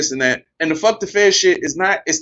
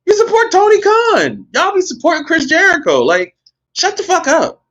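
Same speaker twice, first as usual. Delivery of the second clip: exasperated high rant; 11 semitones higher than usual. Real, not acted. Ranting at fans who pick sides, high fed-up yell.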